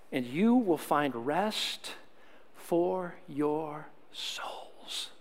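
slow, soft, lingering read; unhurried scripture reading trailing to a whisper. Shown as a demonstration. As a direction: read each phrase slowly, letting it sink in. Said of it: "I'm gonna do it a little slower".

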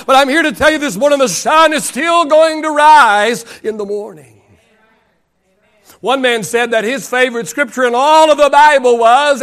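impassioned sermon delivery; rolling pulpit cadence; preaching. Real, not acted. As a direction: preach it with driving force, stretching key words. Preaches at full force.

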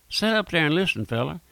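ordinary delivery

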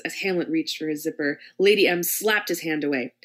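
ordinary delivery

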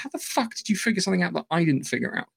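a light imitation of furious disbelief; retold anger. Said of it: "How evolutionary is really angry".

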